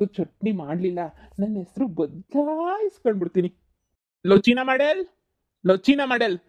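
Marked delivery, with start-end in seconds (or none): 4.3-6.4 loud, higher shouted call; shouting. Acted. Uncle shouts for the Chinese boy, niece objects. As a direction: bellow his name through the house. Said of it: "ಇದ್ ಯಾಕೆ ಹಿಂಗ್ ಕಿರ್ಚ್ಕೊತಾ ಇದೀರಲ್ಲ".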